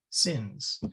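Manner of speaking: ordinary talk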